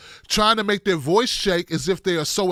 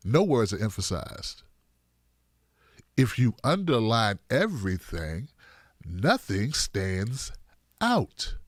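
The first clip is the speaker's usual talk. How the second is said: much lower, softer, slowing to separate words; deadpan punchline; 10 semitones lower, 6 dB quieter, slower than usual. Shown as a demonstration. Host lecturing on over-emphasis, deadpan and low. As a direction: drop low and quiet, dragging out the ending.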